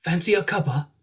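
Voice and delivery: American trying Fancy a cuppa in British; a first go at a British Fancy a cuppa; attempted British accent. Shown as a demonstration. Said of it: "That was very good".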